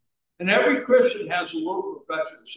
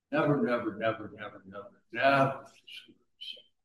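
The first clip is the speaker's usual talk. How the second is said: softening plea; 7 semitones lower, 8 dB quieter, faster than usual. Acted. Repeated never, softening.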